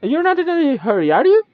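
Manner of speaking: high, fast, rising sprite voice; teasing creature. Acted. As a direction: needle them for dawdling.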